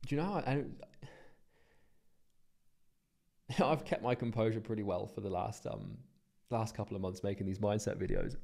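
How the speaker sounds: holding back tears while talking; emotional. Real, not acted. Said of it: "I'm quite emotional about this".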